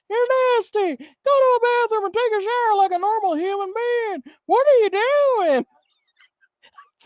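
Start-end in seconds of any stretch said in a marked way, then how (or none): 0.1-5.7 put-on high, strained scolding old man voice; disgusted old man. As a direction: squawk at her like an offended old man. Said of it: "he's like".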